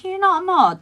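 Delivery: shocked disbelief. Real, not acted. Streamer finding a regular viewer isn't a mod, shocked.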